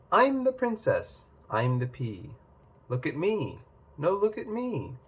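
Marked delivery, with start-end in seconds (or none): 0.1-1.3 high, proud princess announcing herself; grand princess. Acted. 4.0-5.0 attention-seeking. Acted. Pea and princess vie for attention.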